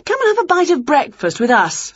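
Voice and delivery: high, lively invitation; Winnie, inviting. A narrator's reading.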